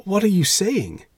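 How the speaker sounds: very high, loud shocked question; aghast, pitch leaping up; amazed exclamation. A narrator's reading. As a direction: exclaim as if scandalised. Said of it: "amazed at my calm".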